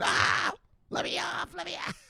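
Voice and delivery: high, frantic plea to get off; mock panicked scream. Imitating screaming bus passengers begging off. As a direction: wail like a terrified passenger. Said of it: "Everyone's screaming".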